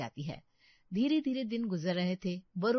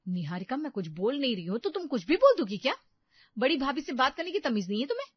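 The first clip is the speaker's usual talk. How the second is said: loud, higher furious scolding; angry yelling; 5 semitones higher, 8 dB louder, faster than usual. Acted. Barkha shouts at her insolent sister-in-law, angry yelling. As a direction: yell at her, quickening at the end. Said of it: "बहुत जोर से चिल्लाती है".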